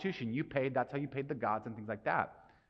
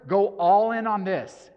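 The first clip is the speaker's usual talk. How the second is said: emphatic urging; 5 semitones higher, 12 dB louder, slower than usual. Real, not acted. Preacher urging the congregation.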